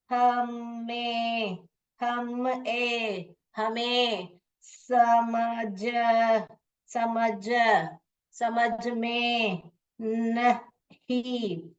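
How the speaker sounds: "हमें समझ में नहीं" dragged out very slowly; slow decoding. Shown as a demonstration. Teacher slowly decoding a student's typed Hindi-in-English message. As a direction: read it out syllable by syllable, stretched. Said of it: "Hindi में आपने sound को ही type किया है".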